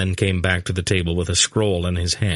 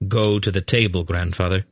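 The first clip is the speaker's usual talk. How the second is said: ordinary delivery, nothing marked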